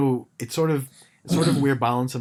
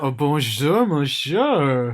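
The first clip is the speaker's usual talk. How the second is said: louder, higher hammed-up French greeting; mock French accent; 4 semitones higher, 8 dB louder, much slower than usual. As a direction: tease with an over-the-top French accent. Friend jokes in mock French.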